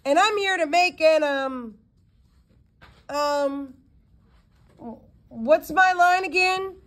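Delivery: mock leading-man bravado; mimicking Johnny Lott. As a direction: send up the actor with a grand, lofty line. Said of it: "mimicking him".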